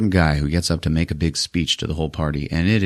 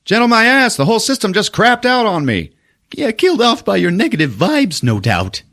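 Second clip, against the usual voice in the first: much higher, louder hammed-up squabble; mock bickering; 14 semitones higher, 3 dB louder than usual. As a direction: bicker like B-movie leads. Retold argument voiced.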